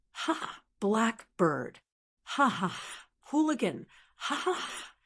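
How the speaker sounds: acted laughing in read-out ha-has; wild laughter. A narrator's reading. Narrator voicing a man laughing at a blackbird, mock wild laughter. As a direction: laugh in spoken syllables at the bird. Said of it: "laughed wildly".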